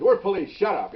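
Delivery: loud, firm cop command; forceful retort. Retold or imitated. Officer shouting back at the yelling suspect, forceful retold retort. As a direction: snap back loudly, easing slightly at the end.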